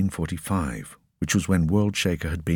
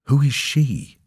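sharp, higher demand; 8 semitones higher than usual. A narrator's reading. Boy demanding the officers tell him who she is. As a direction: insist on an answer. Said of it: "Coll demanded".

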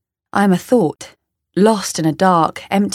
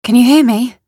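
raised, higher-pitched calls for attention; calling out; 6 semitones higher, 6 dB louder than usual. Acted. Narrator asks if anyone can hear, calling out. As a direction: call louder each time to be heard. Calling out to unseen people, unheard.

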